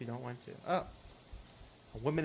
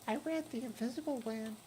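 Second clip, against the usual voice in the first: put-on much higher, thin ghostly girl voice; hitchhiker Lydia; 9 semitones higher, 3 dB quieter than usual. Hitchhiker asking the player for a ride, high ghostly voice. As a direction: play the strange girl in the rain begging a lift.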